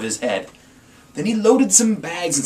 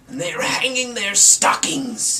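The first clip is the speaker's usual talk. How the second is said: snarling Grinch; 8 semitones higher, 5 dB louder than usual. Acted. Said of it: "he snarled with a sneer".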